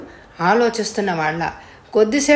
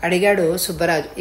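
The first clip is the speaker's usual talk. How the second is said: plain reading voice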